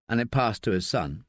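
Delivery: ordinary delivery, nothing marked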